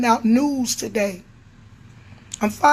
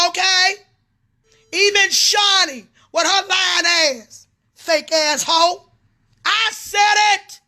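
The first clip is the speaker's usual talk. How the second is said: real outrage, raised into shouts; angry accusation; 3 semitones higher, slower than usual. Real, not acted. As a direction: call out the liars, drawing out key words. Angrily accuses preachers of lying.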